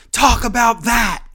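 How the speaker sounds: loud, high yell imitating a wrestler; imitated yell. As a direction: yell it at the commentators, mimicking her. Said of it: "She yells at the commentators".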